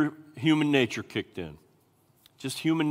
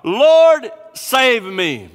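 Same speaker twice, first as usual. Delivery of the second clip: much louder and higher plea, "Lord" shouted; earnest cry; 9 semitones higher, 11 dB louder, much slower than usual. Shown as a demonstration. Preacher showing the congregation the right cry, loud and urgent. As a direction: call on the Lord with everything. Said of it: "No, no, no, no".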